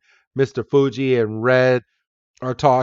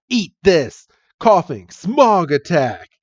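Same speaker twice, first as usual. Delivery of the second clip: high, emphatic attack call; battle command; 5 semitones higher, 3 dB louder than usual. Acted. Team Rocket grunt commanding Koffing, battle call. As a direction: call the attack out like a cartoon villain.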